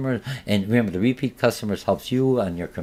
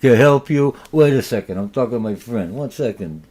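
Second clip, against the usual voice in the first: loud, dismissive shop clerk who brushes the customer off; a bored clerk who can't be bothered; rude salesperson; 2 semitones higher, 7 dB louder than usual. Acted. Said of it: "the person comes to me and says".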